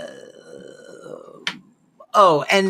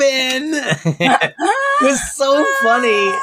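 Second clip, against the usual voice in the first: high, slow words through laughter; laughing so hard it breaks; 8 semitones higher, much slower than usual. Real, not acted. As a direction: squeeze it out while cracking up. Laughing after Yetta quote.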